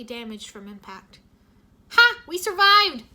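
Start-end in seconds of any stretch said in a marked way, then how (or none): 1.9-3.0 very high, loud victory whoop; [name] celebrating the landing, loud joyful whoop. Acted. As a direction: celebrate surviving the crash at full voice. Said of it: "[name] whooped".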